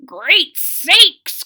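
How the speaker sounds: put-on high, loud cracked old-man voice; excited old man croak; croaky old man. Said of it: "croaked the old man".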